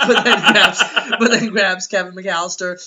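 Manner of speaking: ordinary talk, nothing marked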